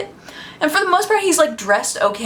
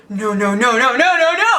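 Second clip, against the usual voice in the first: laughing panic; 3 semitones higher than usual. Retold or imitated. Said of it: "I was like".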